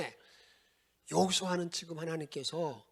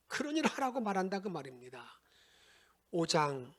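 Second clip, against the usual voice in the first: usual speaking voice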